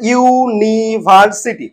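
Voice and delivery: "University" slow and drawn out part by part; split into syllables. Shown as a demonstration. Teacher asking how many parts, "university" slowly split up. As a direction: lengthen and separate each syllable of "university". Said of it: "কটা ভাগে ভাঙলাম".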